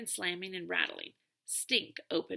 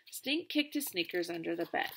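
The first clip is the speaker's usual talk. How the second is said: ordinary delivery, nothing marked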